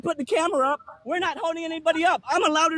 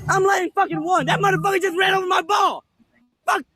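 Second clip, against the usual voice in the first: louder furious outburst; angry shout; 3 semitones higher, 4 dB louder than usual. Real, not acted. Golfer raging that the marshal's cart ran over his ball, furious shout. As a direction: blow up over the ruined shot.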